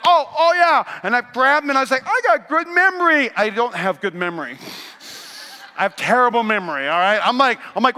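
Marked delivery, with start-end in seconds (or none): 0.1-3.3 high, loud self-satisfied boast; proud of finding it; pleased with self. Retold or imitated. Preacher retells finding iPad.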